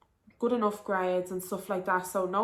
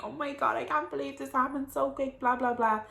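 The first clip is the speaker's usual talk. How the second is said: higher-pitched mock panicked wail; replayed tearful panic, pitched up; retold panic; 6 semitones higher than usual. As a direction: imitate yourself panicking, lightly hammed-up. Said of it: "I was crying".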